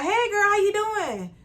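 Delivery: high, slow singsong hello; cheery check-in greeting. Retold or imitated. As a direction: greet a friend high and drawn-out. Acts cheery phone greeting to friend.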